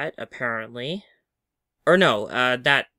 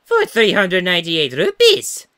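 louder, sly sales pitch; greedy character voice; 5 semitones higher, 9 dB louder than usual. Acted. Voicing game character.

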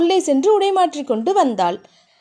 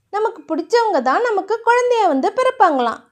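put-on higher, wide-eyed girlish telling; naive girl; 7 semitones higher than usual. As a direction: share a cute belief earnestly. Narrator voices Thiya.

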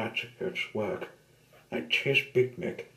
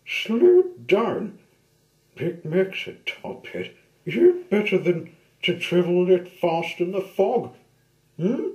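high, loud prim scolding; prim lady voice; 9 semitones higher, 8 dB louder than usual. Acted. Top Hat scolds Big Mick.

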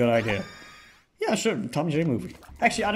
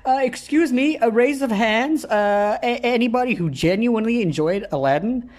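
higher, louder polite interviewer; hammed-up survey taker; 8 semitones higher, 4 dB louder than usual. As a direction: address the daycare kids with a clipboard. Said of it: "go there with my clipboard".

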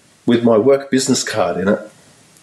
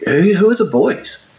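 naive question; 9 semitones higher than usual. Retold or imitated. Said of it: "in my naivety".

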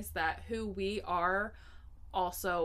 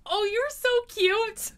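loud, high oh, you're so cute through frustration; frustrated tone; 13 semitones higher, 8 dB louder than usual. Retold or imitated. Nanny praising a kid while frustrated, loud and high. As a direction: say something sweet while fed up. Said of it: "frustrated tone of voice".

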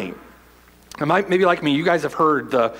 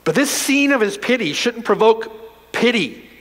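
ordinary delivery

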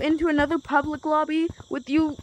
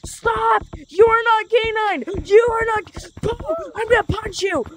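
higher, loud mock-angry yelling; 7 semitones higher, 4 dB louder, slower than usual. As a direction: yell at him to stop. Yelling at impostor friend.

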